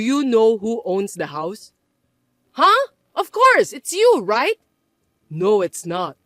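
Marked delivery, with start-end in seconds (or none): 2.5-4.6 much higher, louder startled reply; shocked. Acted. Mother startled by her son's question, surprised. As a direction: caught off guard.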